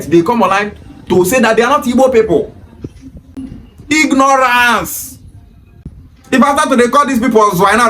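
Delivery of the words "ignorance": very slow, higher-pitched single word; drawn-out dismissal. Real, not acted. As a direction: stretch the word out long as a verdict. One-word dismissive verdict, drawn out.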